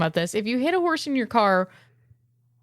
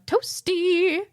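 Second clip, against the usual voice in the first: much higher game announcer voice; Mortal Kombat dude; 9 semitones higher than usual. Retold or imitated. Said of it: "sounded like the Mortal Kombat dude going".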